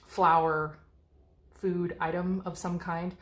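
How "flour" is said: normal talking voice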